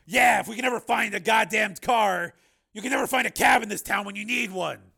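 high, loud grumbling; Steele. Acted. Steele griping to Raven about finding a car, loud and exasperated. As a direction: complain at full voice, pitched well up.

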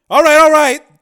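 very high, very loud surrender; panic-stricken cry. Acted. Father sees his son shot, panic-stricken cry. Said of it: "cried Borodini, panic-stricken".

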